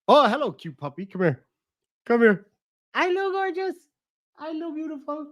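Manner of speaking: high sing-song puppy talk. Real, not acted. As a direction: coo at the puppy.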